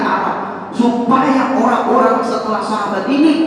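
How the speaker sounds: preacher rising to full force; preaching. Acted. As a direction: drive the point home to the congregation. Preaching climax.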